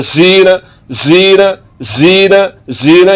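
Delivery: जीना as buzzing 'zeena'; wrong whistling z. Shown as a demonstration. Teacher repeating what not to say, whistling z. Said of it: "सीटी की आवाज़ पैदा हो रही है".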